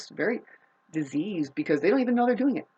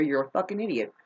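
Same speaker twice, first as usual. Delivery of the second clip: ordinary talk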